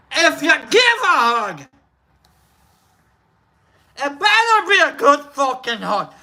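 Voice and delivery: high, forceful Scottish accent. Shown as a demonstration. Streamer translating the hug saying, emphatic Scottish accent. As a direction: belt it out as a brash Scot. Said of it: "In Scottish".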